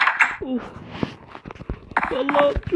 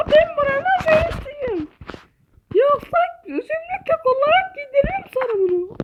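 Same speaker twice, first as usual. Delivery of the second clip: boss voice; 9 semitones higher than usual. Acted. Boss character threatens player.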